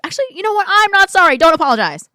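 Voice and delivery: high, loud, emphatic retraction; suddenly loud and insistent; defiant outburst. Real, not acted. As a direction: burst out loudly, refusing to apologize. Takes back the apology, defiant.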